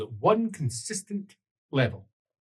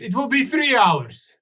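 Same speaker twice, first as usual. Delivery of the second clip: high, raised-voice announcement; raised pitch and volume, falling at the end; called out; 10 semitones higher, 5 dB louder than usual. Acted. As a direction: call it out to the passengers. Captain calls out corrected time.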